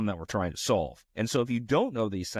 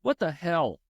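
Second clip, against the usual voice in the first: high, louder exasperated outburst; frustrated outburst; 3 dB louder than usual. Real, not acted. Coder losing patience with stuck code, exasperated outburst. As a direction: blurt it out in disbelief.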